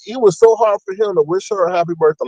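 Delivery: ordinary delivery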